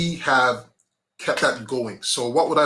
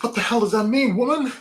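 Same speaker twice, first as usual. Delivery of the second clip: mock annoyed; 8 semitones higher than usual. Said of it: "getting pissed off".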